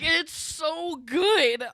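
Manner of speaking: very slow, high, loud, drawn-out rave; blissful food rave. Retold or imitated. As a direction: savour every word like heavenly dessert. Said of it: "I was like".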